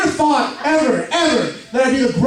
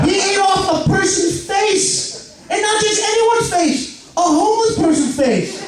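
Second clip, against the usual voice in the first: incredulous shout; 11 semitones higher, slower than usual. Real, not acted. Outraged comedic build.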